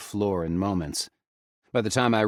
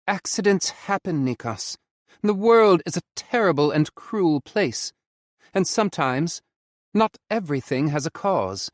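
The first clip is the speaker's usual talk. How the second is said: a higher, gentle consoling voice; 11 semitones higher, 6 dB louder than usual. A narrator's reading. Ollie consoling Nikos as he cries. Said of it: "Ollie consoles me".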